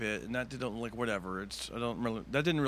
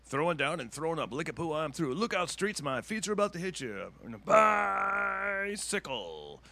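radio DJ patter; 6 semitones higher, slower than usual. Retold or imitated. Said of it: "a rip-off of, like, a radio D J".

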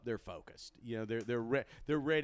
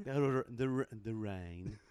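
stumbling put-on British; British accent drill. Guest repeating after the host, halting British elocution. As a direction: practise the sound in a British accent. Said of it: "Now repeat after me".

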